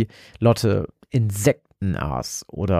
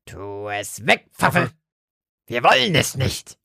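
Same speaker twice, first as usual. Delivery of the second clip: croaking; 2 semitones higher, slower than usual. Acted. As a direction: croak it like a possessed girl. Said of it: "krächzte Lotte".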